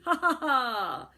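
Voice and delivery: loud, slow wicked ha ha; wicked gleeful laugh. Acted. Boy villain laughs with wicked glee, loud and slow. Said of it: "laughed with wicked glee".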